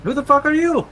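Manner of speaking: very high loud demand; high shout. Acted. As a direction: shriek it back. Characters trade the same shout.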